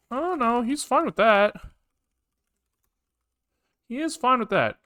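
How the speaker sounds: ordinary talk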